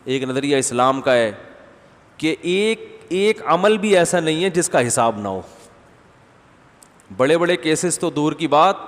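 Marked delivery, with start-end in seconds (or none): none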